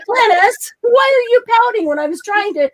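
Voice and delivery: high, loud mock reproach; mock-hurt pleading with a pouting pet; playful scolding. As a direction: coo a pretend telling-off, pitched right up.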